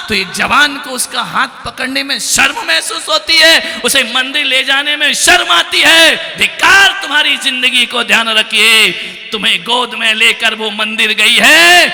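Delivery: high-pitched forceful rebuke; scolding sermon. Real, not acted. Preacher rebukes sons ashamed to hold parents' hands, forceful scolding. Rebukes ungrateful young people.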